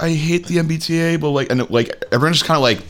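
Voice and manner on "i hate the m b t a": high-pitched put-on Russian complaint; mock Russian accent. Host imitating the crying woman on the train, mock Russian accent. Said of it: "and then she's like, she's like".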